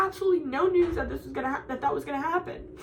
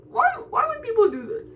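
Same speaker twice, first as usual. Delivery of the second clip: higher, louder plaintive wail; distressed whine; 6 semitones higher, 6 dB louder than usual. Real, not acted. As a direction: plead in real exasperation, close to tears. Venting in distress about school.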